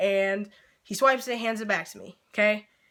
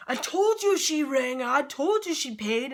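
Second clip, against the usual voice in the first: higher, drawn-out call to another room; stretched-out, pitched-up retold shout; mock yell; 4 semitones higher, slower than usual. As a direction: imitate yelling back to the kitchen, without full volume. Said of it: "turns back to the kitchen and yells".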